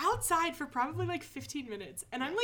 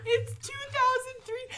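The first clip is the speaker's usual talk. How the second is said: high, teary whine; half-laughing fake sob; mock panicked whimper; 9 semitones higher than usual. Retold panicked answer to cop.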